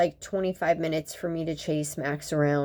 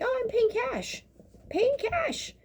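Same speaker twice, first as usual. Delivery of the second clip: much higher, airy mock voice; mocking entitled patient. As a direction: parody a smug patient flaunting cash. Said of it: "you know, be like".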